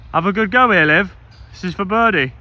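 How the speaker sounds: calling out loudly to partner; big loud cheer from afar; loud encouragement. Real, not acted. Cheering partner on her throw.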